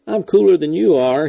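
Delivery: loud, high, impatient bark. A narrator's reading. Irritable astronaut radios the blockhouse, loud impatient bark. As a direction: snap it at mission control. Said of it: "he barked".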